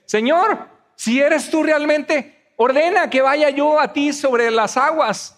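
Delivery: high, slow, deliberate acted appeal; stretched-out earnest plea, imitating Peter; calling out. Acts Peter calling to Jesus.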